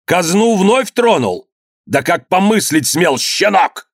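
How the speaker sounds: high-pitched, outraged rebuke; furious scolding. A narrator's reading. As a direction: rage at a disobedient son. Father raging at his son. (гневная отповедь, на высоких тонах)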